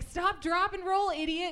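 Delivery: yelling stop, drop and roll; yelled instruction. Retold or imitated. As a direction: shout it at him like he's an idiot. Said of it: "I was like".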